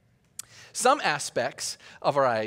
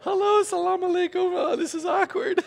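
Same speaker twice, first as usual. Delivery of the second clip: sheepish greeting; 13 semitones higher than usual. Retold or imitated. Pastor answering the shouting sellers, high and sheepish. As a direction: answer politely while squirming. His own awkward reply retold.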